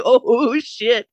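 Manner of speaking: loud startled exclamation; shocked. Real, not acted. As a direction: blurt it out in shock. Seeing cops surround him.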